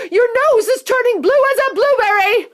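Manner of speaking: very high, loud frantic scream. A narrator's reading. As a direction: shriek in horror. Frantic mother.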